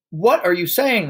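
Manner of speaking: high, alarmed cry; cried out. A narrator's reading. Husband hearing about the lavatory chemical, crying out. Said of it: "the husband cried".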